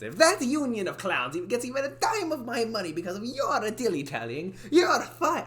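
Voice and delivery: high, put-on boss rant, half laughing; angry accented boss. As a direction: build to the firing in character. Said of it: "in a voice like that".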